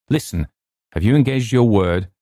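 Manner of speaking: ordinary delivery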